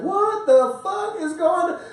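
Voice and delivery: high slow indignant outburst; imitating himself getting mad at his woman; mock outrage. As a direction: act out a slow shocked blow-up.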